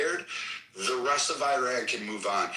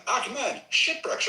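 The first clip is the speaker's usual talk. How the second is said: high called-out name; calling out to Ahmed in the room; 10 semitones higher, much slower than usual. Real, not acted. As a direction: call his name across the room. Calling out to a listener.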